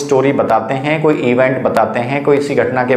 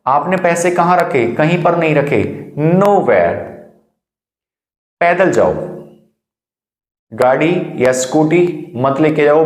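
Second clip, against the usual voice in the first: ordinary talk, nothing marked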